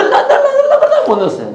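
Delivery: high, words broken up by laughter; laughing. Real, not acted. Preacher admitting he left a part out, laughing. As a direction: laugh through a stumbling admission. Laughs at own cut material.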